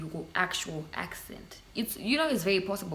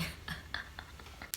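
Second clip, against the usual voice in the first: soft breathy laugh; 13 dB quieter than usual. Real, not acted. Laugh alone.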